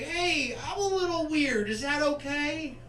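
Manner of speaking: put-on high, coy girl voice; mock quirky girl. Girl at the bar admitting she is weird, high and coy. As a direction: play her shy weird-girl pitch. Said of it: "she's like".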